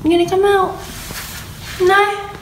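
high coaxing pet talk. Real, not acted. Coaxing rabbit out of hutch.

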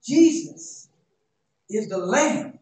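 loud, high, stretched preacher cry; climbing sermon fervor; full-force preaching. Acted. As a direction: preach it out to the whole church.

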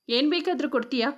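plain reading voice

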